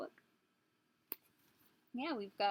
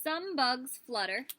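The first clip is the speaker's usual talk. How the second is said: put-on reading to children; 2 semitones higher, 6 dB louder, slower than usual. Reading book aloud.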